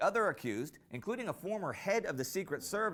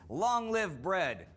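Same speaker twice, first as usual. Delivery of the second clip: drawn-out, raised protest cry; rallying slogan; 4 semitones higher, 5 dB louder, much slower than usual. Acted. Mutineers cheering for food, rallying slogan. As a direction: proclaim it slowly to the crowd.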